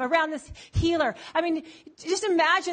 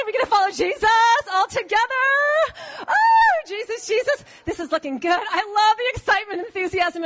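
gushing follower. Acted. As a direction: bubble over with excitement. Plays gushing follower long.

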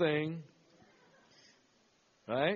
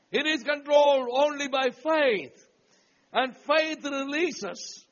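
full-voiced preacher telling a Bible story; storytelling sermon voice; preaching; 6 semitones higher, 5 dB louder than usual. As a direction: preach it out to the congregation, rising on the punch lines.

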